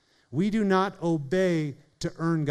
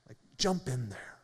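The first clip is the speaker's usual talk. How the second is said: voicing God's invitation; 2 semitones higher, 3 dB louder than usual. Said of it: "He's like".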